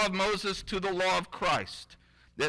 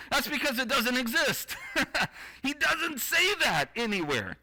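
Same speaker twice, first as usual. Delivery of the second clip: higher-pitched, breaking into a laugh; amused exclamation; 6 semitones higher than usual. Real, not acted. Preacher revealing a missing verse to the congregation, laughing. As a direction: laugh between the phrases, voice lifted.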